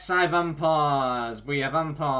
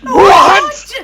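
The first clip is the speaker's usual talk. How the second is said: high startled yelp with a laugh; shocked shriek; 19 dB louder than usual. Acted. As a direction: squeal it out in disbelief. Shocked shout in the reading.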